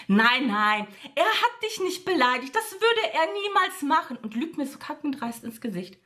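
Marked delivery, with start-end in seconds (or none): 0.1-4.2 Freundin höher nachäffen, abwiegelnd; nachgeäffte Freundin. Retold or imitated. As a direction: die Freundin spöttisch hoch nachmachen. Streit, Freundin wird nachgeäfft. (Ich erzähle, wie die Freundin lügt: höher nachgeäfft)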